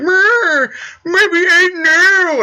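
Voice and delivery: high, loud, dragged-out mock whine; a fanboy's mock whine over a traitor droid; mock crying fan. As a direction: wail it like a heartbroken fanboy. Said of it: "fanboys and fangirls will be like".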